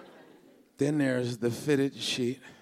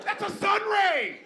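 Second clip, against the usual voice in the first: exasperated shout; slower than usual. Acted. Arguing back at complaint.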